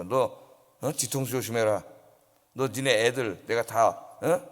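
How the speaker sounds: lightly voiced thug intimidating; gangster threat. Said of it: "이런 식으로 겁을 줘요". (조금 낮게 흉내 낸 깡패의 협박)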